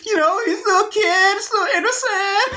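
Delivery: high voice breaking with laughter; cracking up mid-sentence; laughing. Real, not acted. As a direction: squeak it out through laughter. Cracking up while explaining.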